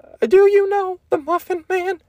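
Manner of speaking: very high, squeaky cartoon cookie; put-on high cartoon character voice; Gingy. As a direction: pitch it way up like a tiny cookie. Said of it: "He kinda talks like this".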